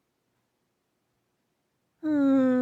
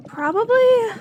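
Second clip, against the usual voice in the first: higher and louder, a blurted afterthought; sudden loud doubt; 8 semitones higher, 8 dB louder than usual. Acted. Alchemist admitting doubt to her visitor, blurted loudly. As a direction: blurt it out, unsure.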